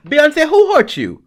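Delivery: high, loud mock outrage; who hurt you. Real, not acted. Host shouting playful outrage about [name]'s performance, high and loud. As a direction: call it out high and loud, half joking.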